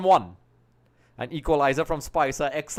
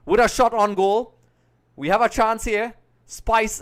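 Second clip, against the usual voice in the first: rising, louder as the shot comes in; excited commentary; 7 semitones higher, 7 dB louder than usual. Real, not acted. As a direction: call the chance with real excitement. Live commentary of a shot on goal.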